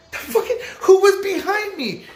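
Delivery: very high, incredulous squawk; baffled disbelief. Retold or imitated. Accused resident answers Mario, high-pitched baffled disbelief. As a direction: act out total bewilderment at the charge.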